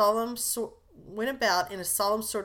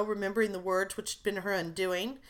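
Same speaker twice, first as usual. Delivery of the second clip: usual speaking voice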